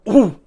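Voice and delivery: loud, high, falling "ooh"; short, sharp burst of reaction; startled "ooh". Real, not acted. Game master seeing the melee roll, startled ooh.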